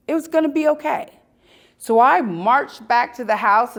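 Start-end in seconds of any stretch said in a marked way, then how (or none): 0.1-1.2 "It was gonna be okay" repeated quicker and brighter, stress on "gonna"; emphatic repeat. Real, not acted. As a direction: say it again faster with sure conviction.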